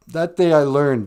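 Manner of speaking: normal talking voice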